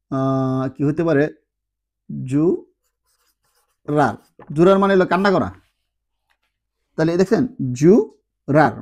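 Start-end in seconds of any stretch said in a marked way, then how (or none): 2.3-4.1 জু, pause, রার, syllable by syllable as jurar; slow syllabified demo. Shown as a demonstration. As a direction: split জুরার into জু and রার slowly. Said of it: "double L".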